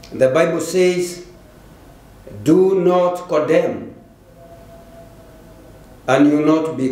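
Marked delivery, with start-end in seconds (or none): none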